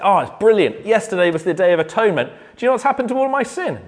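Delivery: high, fast, delighted burst of joy; joyful excited Israelite. Retold or imitated. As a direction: gush about your sins being gone. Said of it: "why they're smiling and say".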